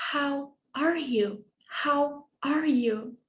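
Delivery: slow, clear teacher model; slow model phrase. Shown as a demonstration. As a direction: say it slowly for students to copy. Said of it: "I would like you to repeat".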